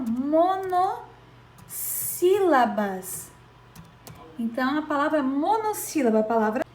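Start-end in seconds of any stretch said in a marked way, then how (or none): 0.0-3.3 slow "monossílabas" as mono, long pause, sílabas; syllable emphasis. Shown as a demonstration. Teacher naming the class for pupils, "monossílabas" slowly split. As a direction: stretch both halves of "monossílabas" apart. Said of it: "a gente diz que elas são".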